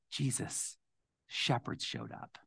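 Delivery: hushed, secret telling; whisper. Acted. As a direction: lean in and whisper it like a bedtime secret. Parents telling Jesus his birth story.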